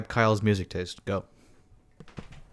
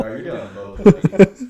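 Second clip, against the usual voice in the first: loud, slow, high disbelief; mock-outraged question; slowly, loudly amazed; incredulous; 10 semitones higher, 10 dB louder, slower than usual. Real, not acted.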